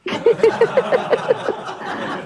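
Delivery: helpless high giggle; can't hold back the giggles; high breathless laugh. Real, not acted. Host cracks up retelling story.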